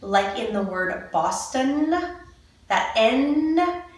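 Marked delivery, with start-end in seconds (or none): 1.1-1.9 BOSTON with the final N held long. Shown as a demonstration. Teacher showing tongue-tip placement, BOSTON with a drawn-out N. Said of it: "if you say the letter N". 3.0-3.8 N drawn out long and higher; sustained N sound. Shown as a demonstration. Teacher showing the spot, a long high N. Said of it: "if you say the letter N".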